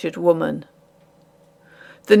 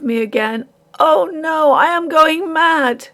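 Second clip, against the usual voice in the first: high, raised despairing lament; 6 semitones higher than usual. Acted. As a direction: lament on the edge of breaking.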